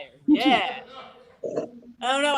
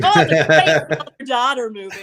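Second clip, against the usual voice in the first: a hint of a proud dad, louder; mock dad, loud; 7 dB louder than usual. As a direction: voice dad praising his movie, louder. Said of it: "He's like".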